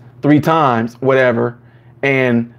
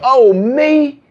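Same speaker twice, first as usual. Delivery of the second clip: higher, pained oh me; Florida hurt foot exclamation; 9 semitones higher than usual. Retold or imitated. Floridian reacting to a hurt foot, mock pained. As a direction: groan it like you stubbed a toe. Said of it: "they go".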